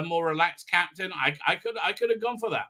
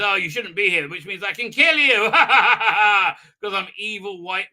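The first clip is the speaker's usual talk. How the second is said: hammed-up villain threat with a big cackle; mock evil villain; 4 semitones higher, 6 dB louder than usual. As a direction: build to a loud, gloating evil laugh. Villain voiced with evil laugh.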